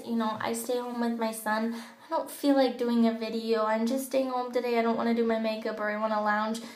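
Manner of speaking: usual speaking voice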